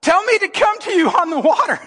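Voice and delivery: high-pitched, eager call; Peter calling out. Acted. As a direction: beg to be called out onto the water. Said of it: "Peter replied".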